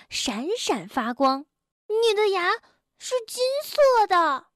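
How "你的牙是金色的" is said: higher, astonished friend; surprised. A narrator's reading. Tiaotiao stares at the doctor's gold tooth, amazed. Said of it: "跳跳诧异地说".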